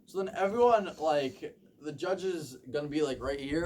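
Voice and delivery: ordinary talk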